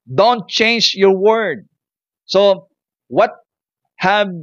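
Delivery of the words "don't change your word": "Don't change your word" much slower and higher, every word weighted; slow emphatic repeat. Shown as a demonstration. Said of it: "Do not give up, my friend". (dahan-dahan at mariing "Don't change your word")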